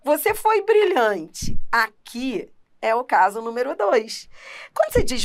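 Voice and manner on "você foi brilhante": high, slow ironic compliment; sarcastic praise. Shown as a demonstration. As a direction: say "Você foi brilhante" as mock praise, pitched up and drawn out. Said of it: "Por exemplo".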